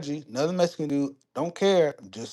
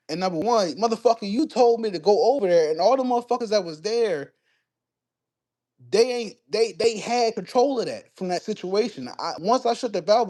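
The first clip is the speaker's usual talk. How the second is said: higher, heated retelling of his angry reply; indignant rant at the supervisor, pitched up; retold upset outburst; 9 semitones higher than usual. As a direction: fire it back at the boss, worked up and high. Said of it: "I get upset".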